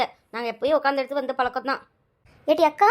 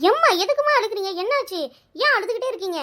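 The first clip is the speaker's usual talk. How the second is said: alarmed daughter; 6 semitones higher, 3 dB louder than usual. Acted. Daughter alarmed at mother crying.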